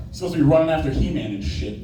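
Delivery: normal talking voice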